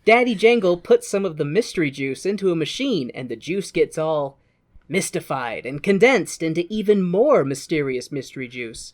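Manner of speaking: ordinary delivery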